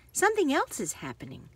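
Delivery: plain reading voice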